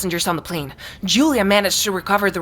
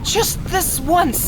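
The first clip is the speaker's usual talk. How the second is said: slow bitter reproach; 8 semitones higher, much slower than usual. Acted.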